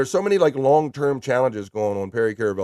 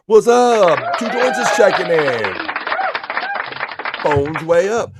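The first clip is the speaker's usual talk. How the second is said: loud excited greeting; 7 semitones higher, 6 dB louder, slower than usual. Real, not acted. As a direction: shout the welcome, high and loud. Loud greeting to arriving chatter.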